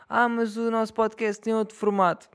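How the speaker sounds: high mocking imitation of other podcasters; mock whiny podcaster. Mocking rival podcasters who edit their show, high and whiny. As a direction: parrot their excuse in a high, silly voice.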